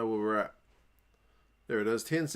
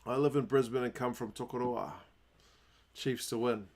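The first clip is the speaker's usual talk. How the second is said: ordinary delivery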